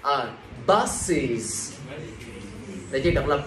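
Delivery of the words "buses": "buses" in two separated syllables, BUS-iz, falling; added syllable. Shown as a demonstration. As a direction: stretch out both syllables of "buses". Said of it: "cộng thêm một âm tiết". (đọc "buses" tách rõ hai âm tiết)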